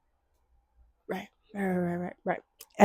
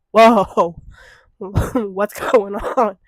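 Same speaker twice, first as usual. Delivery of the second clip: startled whoa; 9 dB louder, much slower than usual. Retold or imitated. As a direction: jolt awake, surprised. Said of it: "I was like".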